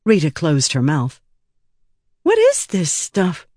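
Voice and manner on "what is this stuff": higher-pitched plaintive question. A narrator's reading. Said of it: "she asked plaintively".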